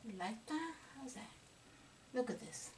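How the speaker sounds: very soft, intimate murmur; whispering. Real, not acted. Painter showing viewers a delicate highlight, whispering. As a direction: whisper as if not to disturb the brush. Hushed during delicate brushwork.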